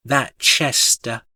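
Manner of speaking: ordinary delivery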